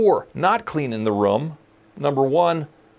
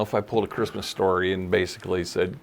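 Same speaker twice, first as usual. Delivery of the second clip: usual speaking voice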